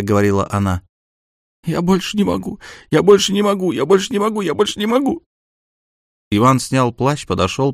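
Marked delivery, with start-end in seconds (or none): 1.6-5.2 high, broken sobbing repetition. Acted. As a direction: sob it out, higher and higher. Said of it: "начала скулить и плакать".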